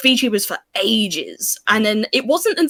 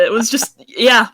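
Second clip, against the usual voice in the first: ordinary talk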